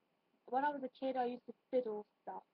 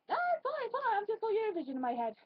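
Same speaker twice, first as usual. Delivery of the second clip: high reassuring; 8 semitones higher, 3 dB louder than usual. Retold or imitated. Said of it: "I'm like".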